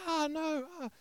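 high, thin mock scream of pain; retold scream. Wife cut by flying glass cries out in shock, high mock scream. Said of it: "she screamed".

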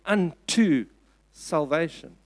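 UNTO drawn out, then a pause; stress on UNTO. Shown as a demonstration. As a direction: hold "unto" firmly, pause, then drop onto "salvation". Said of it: "why am I stressing this".

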